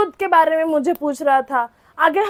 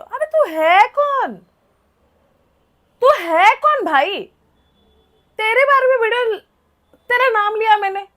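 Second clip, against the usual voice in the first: retold angry retort; 4 semitones higher than usual.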